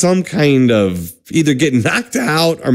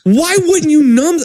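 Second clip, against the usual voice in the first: high, drawn-out complaint; exasperated disbelief; 13 semitones higher than usual. Pretend. Patient questioning absent doctor's choice, exasperated. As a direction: plead the obvious, high and slow.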